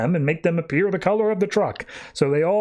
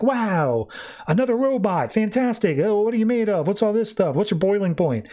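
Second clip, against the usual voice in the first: higher-pitched, mock-amazed chatter; retold excited gushing; 6 semitones higher than usual. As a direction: imitate an eager crowd firing questions, pitched up. Robots gushing over a newcomer, retold.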